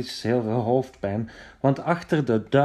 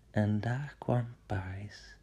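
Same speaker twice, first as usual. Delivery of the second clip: whisper; 2 semitones lower, 8 dB quieter than usual. A narrator's reading. Suspense reveal.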